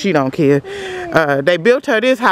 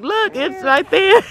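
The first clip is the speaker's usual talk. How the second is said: excited squeal; slower than usual. Retold or imitated. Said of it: "I was like".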